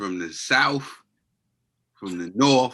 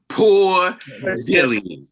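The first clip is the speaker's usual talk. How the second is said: high, loud, all-out yell; group scream; 7 semitones higher, 8 dB louder, much slower than usual. Acted. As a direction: scream it as loud as you can, way higher. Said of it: "Just scream as loud as you can".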